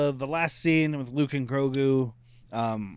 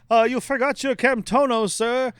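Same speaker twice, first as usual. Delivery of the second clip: high, louder robot attendant voice; 9 semitones higher, 4 dB louder than usual. Acted. Robot calling after departing guests, high droid voice. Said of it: "this robot's like".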